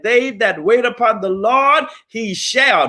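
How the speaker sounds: scripture quoted with a preacher's lift; quoting scripture. Retold or imitated. To the audience, a lightly preached Bible verse. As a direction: lift the verse like a sermon line. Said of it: "a little verse that said".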